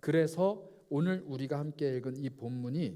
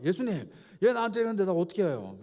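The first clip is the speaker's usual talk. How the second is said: whiny complaint; 3 semitones higher, 5 dB louder than usual. Retold or imitated. Peter tattling to Jesus, a whiny complaint. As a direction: play Peter tattling to Jesus about his brother.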